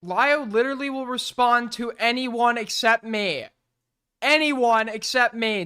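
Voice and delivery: loud, whiny outrage; exasperated complaint. Real, not acted. Streamer venting that Lio ignores him, loud. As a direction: vent it at full volume. Frustrated rant about being ignored.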